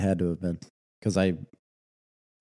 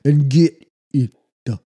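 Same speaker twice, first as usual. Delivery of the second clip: put-on louder, higher, slow Louisiana accent; 6 semitones higher, 7 dB louder, slower than usual. Friend hams up a Louisiana accent asking for gumbo. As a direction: boom it out like a hammy bayou villain. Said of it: "Can you do a Louisiana accent?".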